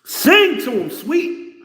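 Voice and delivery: loud, high-pitched holler; calling out. Acted. Egging Sweet on to sing, calling out.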